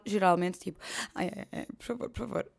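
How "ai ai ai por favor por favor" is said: soft, whiny plea; mild pleading. Retold or imitated. Caller softly pleading on finance office phone. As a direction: whimper please softly. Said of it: "ligo para as finanças".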